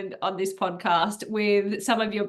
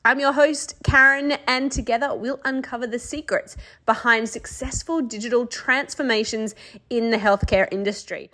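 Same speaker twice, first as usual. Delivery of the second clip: bright, polished announcer read of the show opener; podcast intro read; 3 dB louder than usual. Acted. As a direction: sell the welcome like a radio host.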